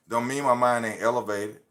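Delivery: usual speaking voice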